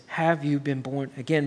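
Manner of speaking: normal talking voice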